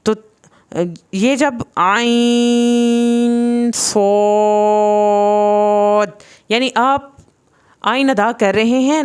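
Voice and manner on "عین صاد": "عین صاد" very slowly, the n hummed through the nose before a long "صاد"; nasalised noon. Shown as a demonstration. Teacher showing noon going into the nose, sustained ghunna. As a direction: hold both letters on a level tone. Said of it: "نون کی آواز آپ کی ناک کی بانس میں جائے گی".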